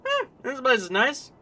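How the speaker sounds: put-on high, lively Canadian accent. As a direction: play him as a broad Canadian. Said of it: "just gonna be Canadians".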